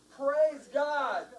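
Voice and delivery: high-pitched rallying shout. Shown as a demonstration. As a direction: shout it for a crowd to repeat. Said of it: "I want all of us to shout".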